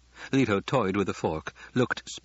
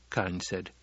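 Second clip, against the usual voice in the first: plain reading voice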